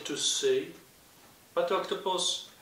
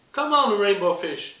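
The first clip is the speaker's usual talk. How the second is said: higher, louder calling; 8 semitones higher, 11 dB louder than usual. Acted. The other fish calling to the rainbow fish, loud and high. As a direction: call him over to play. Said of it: "they called".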